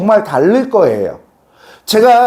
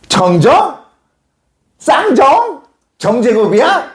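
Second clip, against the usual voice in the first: high, mocking roll-call questions; mock-incredulous teacher reading out a name; teasing teacher; 9 semitones higher, slower than usual. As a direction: imitate a teacher poking fun at a name, pitch jumping up. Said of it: "선생님이 놀리기 시작하니까".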